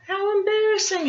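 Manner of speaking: high, embarrassed little voice; high embarrassed squeak; embarrassed hedgehog. A narrator's reading. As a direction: squeak it out, mortified. Hedgie's thought voiced.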